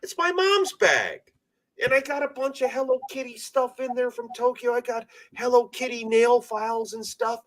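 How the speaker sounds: ranting at critics about honoring his dead, high and heated. Real, not acted. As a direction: tear into the people who mock him. Ranting at people mocking bag.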